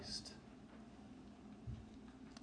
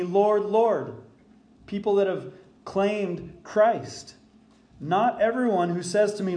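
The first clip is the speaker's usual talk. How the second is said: louder, higher preaching of the words of Jesus; preached scripture quote; 4 semitones higher, 4 dB louder than usual. Retold or imitated. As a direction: proclaim it like scripture from the pulpit. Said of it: "Listen to what he says".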